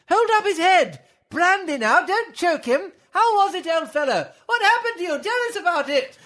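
high, hammed-up crowd of helpers; fussing crowd voices. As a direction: fuss over the fallen lizard.

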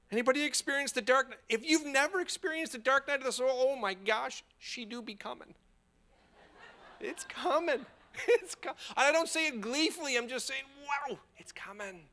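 high-pitched, animated aside to the crowd; comic warning. Real, not acted. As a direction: warn them in a high voice, half joking. Comic warning of dark times.

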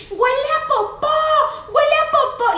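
put-on high, loud kid's shout; child shouting. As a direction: yell it to the whole restaurant. Niece shouting in restaurant.

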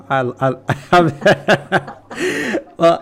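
stammering through laughter; laughing while trying to go on. Real, not acted. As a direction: break up laughing mid-word. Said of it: "I won't say anything".

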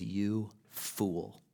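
ordinary delivery

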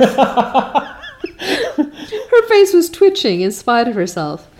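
reading through a real laugh. Real, not acted. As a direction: let the giggle break into the words. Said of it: "laughs while rereading".

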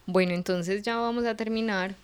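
ordinary delivery

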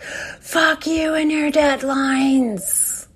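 hammed-up furious outburst; inner monster rage. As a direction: snarl it like a cornered beast. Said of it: "wants to be like".

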